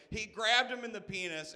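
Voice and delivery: usual speaking voice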